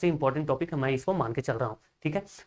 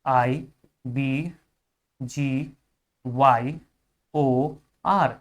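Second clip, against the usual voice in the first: each letter alone with long pauses; spelling it out slowly; 3 semitones lower, much slower than usual. Shown as a demonstration. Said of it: "तो इसको कैसे याद करेंगे". (अक्षर-अक्षर धीरे-धीरे बोलते हुए)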